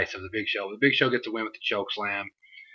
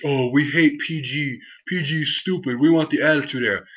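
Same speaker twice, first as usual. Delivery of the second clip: higher, nasal-free mocking whine of a complaining fan; whiny fan mockery; 4 semitones higher than usual. Vlogger mocking fans who complain about PG wrestling, higher whiny voice. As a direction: sneer it like a spoiled fan. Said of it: "These are the people that bitch".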